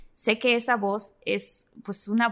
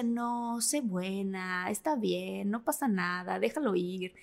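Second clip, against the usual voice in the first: softer, slower, lightly soothing; soothing inner voice; 5 semitones lower, 6 dB quieter, much slower than usual. Retold or imitated. The kind inner voice calming her at the sink: soft, gentle. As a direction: coax herself gently to let it go. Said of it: "otra que me hablaba y me decía".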